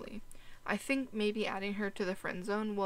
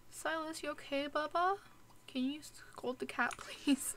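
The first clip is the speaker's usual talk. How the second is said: high cooing pet voice; 7 semitones higher, 3 dB quieter than usual. Real, not acted. Cooing at sim child.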